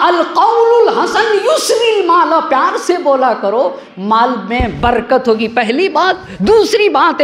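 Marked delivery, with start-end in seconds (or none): 0.1-2.4 Arabic recitation. Acted.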